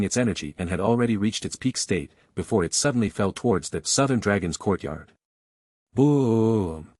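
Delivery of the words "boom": drawn-out, resounding boom; narrated explosion effect, held long; booming thunderclap. A narrator's reading. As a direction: stretch the word out like rolling thunder.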